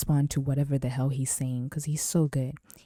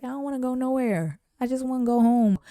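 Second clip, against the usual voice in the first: high, whiny voice begging to go home; reluctant homebody whine; 9 semitones higher than usual. Retold or imitated. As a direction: whine that you just want to go home. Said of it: "But I'm just like".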